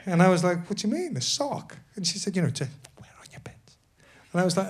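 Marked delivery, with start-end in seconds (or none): 0.7-1.8 baffled question. Retold or imitated. Confused reply to costume girl. 2.4-3.8 a quick whispered aside, fast and breathless; hushed aside. Retold or imitated. Costume girl's discreet explanation.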